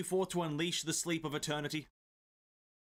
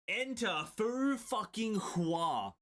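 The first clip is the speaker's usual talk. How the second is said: dramatic announcement; 5 semitones higher, much slower than usual. Acted. Dramatic entrance of a character.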